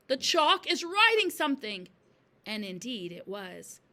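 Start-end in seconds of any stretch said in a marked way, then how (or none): 0.1-1.9 high, loud, excited cry; screaming. A narrator's reading. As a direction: call it out in amazement. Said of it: "screamed Nigel".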